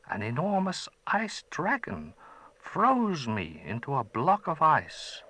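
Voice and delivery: put-on a gentle old wizard, curious; old magician. Old man thanking the boy who freed him, gentle.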